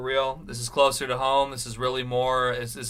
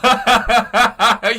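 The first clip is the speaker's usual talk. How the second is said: high burst of laughter; loud laugh; 7 semitones higher, 9 dB louder than usual. Real, not acted. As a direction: crack up loudly.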